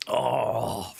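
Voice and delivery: slow, pained groan from spicy food; burning mouth. Real, not acted. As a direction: drag it out with a mouth on fire. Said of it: "my eyes are watering".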